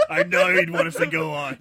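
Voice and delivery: stifle laughter while parodying; laughing parody. Real, not acted. Friend parodying the cave scene, laughing. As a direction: giggle through a mock eulogy.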